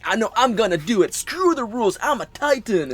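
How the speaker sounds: brash, high hero voice, pushing up in pitch; cocky maverick hero. Retold or imitated. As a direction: play it like a reckless rebel. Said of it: "kinda like".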